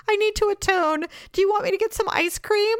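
high, put-on husband voice; imitating an apologetic husband; timid peace offering. Husband timidly offers to make amends.

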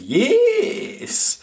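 very high squeaky Scottish "yes"; Scottish character impression. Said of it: "does a Scottish voice and does a".

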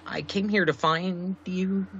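high, flustered stammering; overwhelmed. Acted. Newcomer explaining why they came to find a stranger, overwhelmed. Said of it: "I'm kinda overwhelmed".

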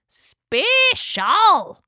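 high, loud pelican squawk; character voice. A narrator's reading. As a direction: squawk it out brightly.

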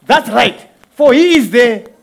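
fiery preaching. Acted. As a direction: preach it like calling witnesses to the stand. Preaching at full force.

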